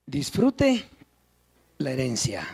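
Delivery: breathy hushed whisper of the words; soft reverent whisper. Real, not acted. Quiet aside after music ends.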